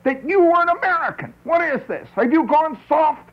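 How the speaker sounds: high, outraged mock voice; hammed-up shocked conservative; indignant right winger. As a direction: squeak it like an appalled patriot. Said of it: "he says".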